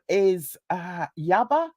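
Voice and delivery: normal talking voice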